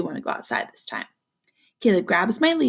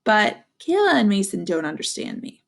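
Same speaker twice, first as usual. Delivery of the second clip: ordinary delivery, nothing marked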